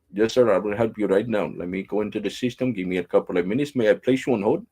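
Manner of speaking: put-on helpful Indian call-centre accent; mimicked Indian English service patter; imitated Indian agent. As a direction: offer help like an Indian phone agent. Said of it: "I'm talking like he was talking to me".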